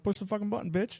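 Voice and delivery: high, softened mock command; retold retort. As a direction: imitate a sassy comeback, pitched well up. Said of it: "I'm like".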